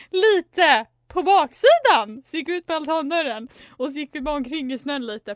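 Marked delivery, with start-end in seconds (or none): 0.1-2.2 loud, high-pitched staged announcement; said loudly. Retold or imitated. Friends announcing their snow cover story for others to overhear, loud. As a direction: call it out extra loudly for others to hear. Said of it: "sa högt".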